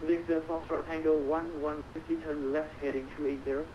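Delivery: ordinary talk, nothing marked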